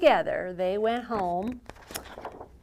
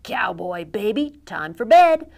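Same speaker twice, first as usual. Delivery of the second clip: sheriff dad; 11 dB louder than usual. Acted. Sheriff Pa voiced in story.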